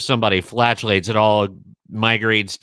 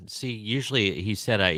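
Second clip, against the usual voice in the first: ordinary delivery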